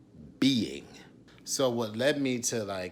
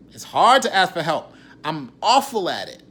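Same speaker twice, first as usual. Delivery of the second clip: much higher and louder; impassioned admission; 6 semitones higher, 14 dB louder than usual. Real, not acted. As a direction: push it out with raw feeling. Emotional admission.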